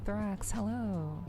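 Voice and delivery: ordinary talk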